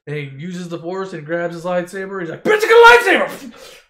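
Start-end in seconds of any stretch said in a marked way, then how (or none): 2.4-3.8 high, loud yelled brag; Luke's victory yell; triumphant shout. Acted. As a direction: scream it like you just won. Luke triumphantly grabbing his lightsaber.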